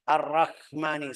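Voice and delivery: "Ar-Rahmani" said as "Ar-Rakmani"; mispronounced as k. Shown as a demonstration. Teacher showing a wrong ha, hardened to k.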